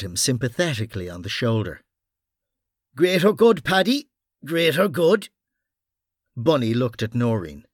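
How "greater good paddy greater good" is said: gruff mock sympathy; Irish detective voice. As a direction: pat him down with words. Bunny consoling after punch.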